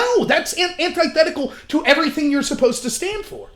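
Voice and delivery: high-pitched incredulous protest; exasperated disbelief. Retold or imitated. Host reacting to a free-speech advocate reporting people, exasperated. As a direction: confront him as if he has lost the plot.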